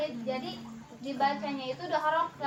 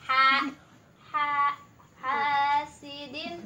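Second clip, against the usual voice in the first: very slow, with a long drawn vowel; slow recitation demo; 4 dB louder, much slower than usual. Shown as a demonstration. Teacher models the long vowel for the student, slow and deliberate. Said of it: "Ini karena ada alif".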